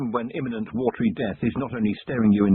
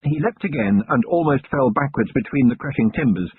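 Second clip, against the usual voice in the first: usual speaking voice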